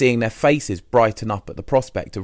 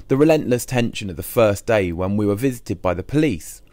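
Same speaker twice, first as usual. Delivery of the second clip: plain reading voice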